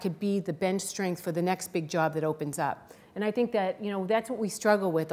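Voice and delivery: ordinary talk, nothing marked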